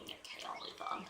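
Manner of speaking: whispered give-up after gushing. Real, not acted. Stopping herself gushing over the book, whispered. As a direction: drop to a whisper and move on.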